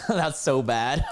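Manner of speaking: laughing at your own bad attempt. Real, not acted.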